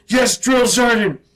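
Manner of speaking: loud, high barked answer to the sergeant; shouted recruit reply. Retold or imitated. Recruit shouting back at his drill sergeant. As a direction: yell it out at attention.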